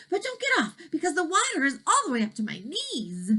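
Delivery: high little dragon voice; lightly voiced dragon.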